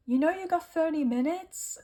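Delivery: a playful attempt at an American Irish lilt; weird American Irish accent. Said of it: "weird American Irish accent".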